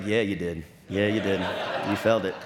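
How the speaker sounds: lightly imitated wife, pitched well down; low, amused, knowing confirmation; genteel, smiling wife. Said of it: "she's just smiling. She's all genteel".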